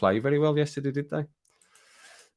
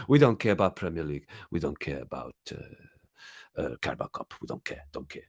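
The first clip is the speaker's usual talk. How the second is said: mock sulky Pep quote; lightly voiced sulky manager; a hint of a pouting bad loser; imitating Pep; 6 semitones lower, 5 dB quieter than usual. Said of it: "he said".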